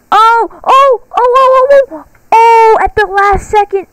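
high loud relieved cries; excited shrieks. Real, not acted. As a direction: shriek with narrow escape excitement. Escapes the seeker narrowly.

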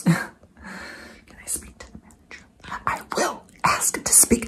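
breathy Karen whisper asking for the manager. Acted. Karen whispering her demand to see the manager. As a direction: hiss it like an entitled Karen plotting a complaint. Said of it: "the Karen".

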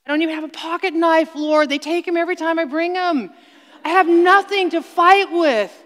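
fast, high desperate complaint; panicked prayer. Retold or imitated. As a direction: gabble it out, caught defenceless. Panicked prayer facing the lion.

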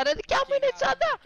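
mock the cousin yelling about her bag; imitating cousin. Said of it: "φώναζε ξαδερφούλα". (μιμείται την ξαδερφούλα που φωνάζει, πολύ ψηλά)

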